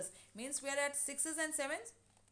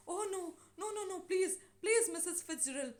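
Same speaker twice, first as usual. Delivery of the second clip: high-pitched acted plea; alarmed pleading; 8 semitones higher, 4 dB louder than usual. Neighbor with the wife's spirit begging her to stop, pleading. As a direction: beg in a raised, urgent voice.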